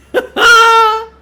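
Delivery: loud cackling laugh; loud high laugh. Real, not acted. Big laugh.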